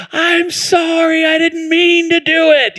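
put-on high, slow pleading little boy voice; tearful little boy. Son in tears apologizing to his sister, high pleading little voice. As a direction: plead like a crying small child.

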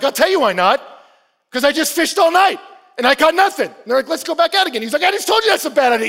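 loud, high, exasperated comeback; frustrated retort. Retold or imitated. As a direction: snap back like a tired, fed-up fisherman. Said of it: "And he's frustrated about it".

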